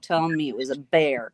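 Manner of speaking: usual speaking voice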